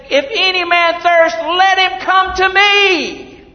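loud, high calling cry; shouting out. Retold or imitated. Jesus calling over the festival crowd, loud high cry. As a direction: call out to the crowd to come. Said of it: "Jesus is shouting out".

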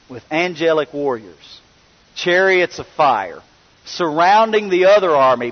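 ordinary talk

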